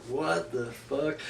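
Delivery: slow, low, barely audible what the fuck; stunned deadpan mutter; muttered disbelief. Retold or imitated. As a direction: drag it out under your breath in disbelief. Reacting to wrestler's face paint.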